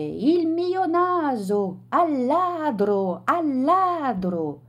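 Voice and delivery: high, slow, drawn-out cries of thief; calling thief. A narrator's reading. Gentleman crying thief from the balcony, slow high shout. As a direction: wail it slowly across the square. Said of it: "gridò il signore".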